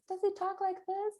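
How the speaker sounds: very high, squeaky monotone character; silly worry voice. Shown as a demonstration. Showing parents how to give a worry a voice, high silly voice. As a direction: squeak it way up high like a tiny creature. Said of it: "You could give it a voice".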